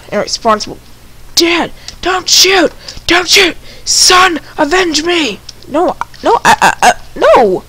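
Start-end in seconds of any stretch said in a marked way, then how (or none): 1.4-7.6 overacted death scene. Hammed-up death scene in game.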